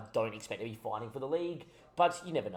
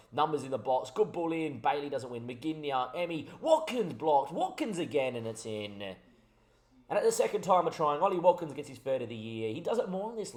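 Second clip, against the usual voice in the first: higher, louder commentator build to the goal; excited goal call; 5 semitones higher, 6 dB louder than usual. Acted. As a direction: climb and roar it as the ball goes in. Goal being called live.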